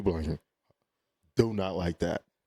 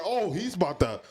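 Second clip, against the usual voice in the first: excited; 9 semitones higher than usual. Retold or imitated. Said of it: "was like".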